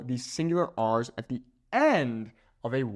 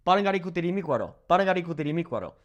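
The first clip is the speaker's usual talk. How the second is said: double-speed repeats of Parangaricutirimícuaro; rapid-fire repetition; ten times fast; 3 semitones higher, much faster than usual. Shown as a demonstration. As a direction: rattle off Parangaricutirimícuaro back to back. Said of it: "Say that ten times fast".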